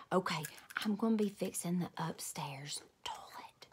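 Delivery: soft whispered secret. A narrator's reading. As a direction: let the secret out in a hush. Grandpa whispered reveal.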